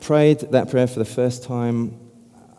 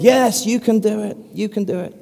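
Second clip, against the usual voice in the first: cheering crowd; 9 semitones higher than usual. Retold or imitated. Crowd urging the tightrope walker on, high excited cheer. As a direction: cheer him on like an eager crowd.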